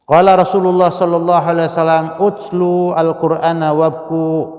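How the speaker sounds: loud preacher quoting the Prophet; proclaiming hadith. Acted. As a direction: announce the Prophet's words with force. Preacher quotes hadith forcefully.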